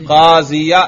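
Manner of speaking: قاضیہ said firmly with final "-ya"; fatha pronounced aloud. Shown as a demonstration. Teacher proving case is audible, fatha pronounced. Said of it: "سننے سے ہی آپ کو پتہ".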